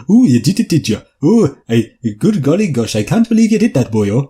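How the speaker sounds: hammed-up sing-song Welsh accent via Indian; mock Welsh accent ending on boyo; fake Welsh accent. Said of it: "just say it's Welsh".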